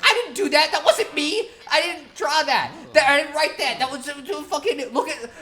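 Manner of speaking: high, stumbling mock protest of innocence; bewildered denial. Accused boy denies writing the note, bewildered high protest. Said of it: "who is just bewildered".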